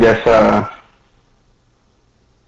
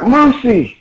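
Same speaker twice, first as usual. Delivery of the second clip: high-pitched anime cry; hammed-up high name call; 13 semitones higher than usual. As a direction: call to a friend in mock alarm. Luffy cries out friend's name.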